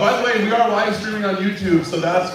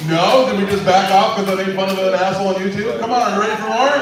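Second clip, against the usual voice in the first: ordinary delivery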